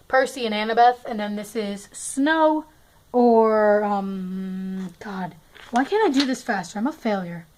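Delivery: ordinary talk, nothing marked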